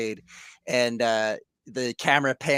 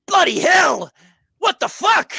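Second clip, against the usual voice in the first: high, loud shocked shout; shocked outburst; 9 dB louder than usual. Acted. Doe reacting to the beheading, high shocked shout. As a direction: yell in horror at what you just saw. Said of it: "even Doe is like".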